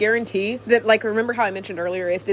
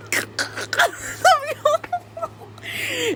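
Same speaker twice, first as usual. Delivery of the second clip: long, high wheezing laughing fit; uncontrollable laughter. Real, not acted. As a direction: lose it completely, squealing with laughter. Cracks up alone at camera.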